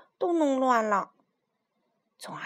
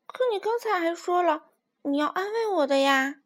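protesting; 6 semitones higher than usual. Acted. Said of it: "抗议道".

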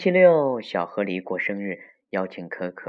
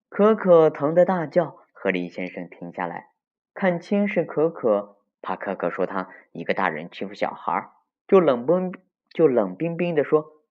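lively storyteller, key phrases punched higher and louder; story reading to children; 5 semitones higher, 6 dB louder than usual. A narrator's reading. Telling children of Mr. Beaver's spanking, lively storytelling. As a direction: tell it vividly to the little ones.

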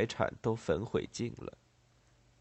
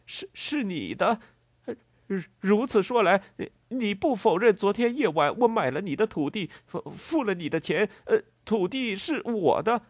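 loud, high-pitched halting insistence; agitated stammering; 11 semitones higher, 6 dB louder than usual. A narrator's reading. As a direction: stammer urgently to secure your claim. Farmer stammers, claims the land. (激动结巴地高声确认)

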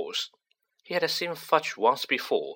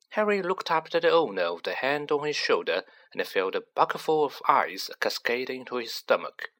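plain reading voice

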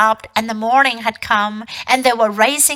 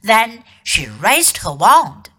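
ordinary delivery, nothing marked

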